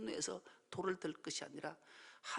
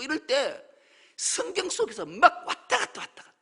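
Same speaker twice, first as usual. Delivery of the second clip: loud, high, driving sermon delivery; full-force preaching; 7 semitones higher, 11 dB louder than usual. Real, not acted. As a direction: build to an excited, high-pitched preaching peak.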